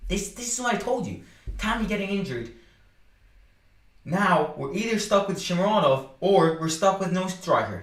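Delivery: frustrated and wound up; agitated, high-pitched. Real, not acted. Excited frustration.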